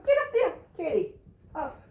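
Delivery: high, laughing urging; egging the dog on through a laugh; laughing encouragement. Real, not acted.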